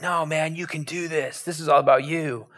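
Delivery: soft, put-on supportive voice; false encouragement. Peter dressing up self-interest as support, falsely encouraging. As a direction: hush it into a mock cheerleading murmur. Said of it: "makes me feel like".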